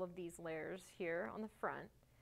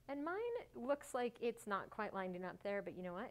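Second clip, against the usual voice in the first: ordinary delivery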